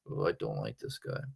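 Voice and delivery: usual speaking voice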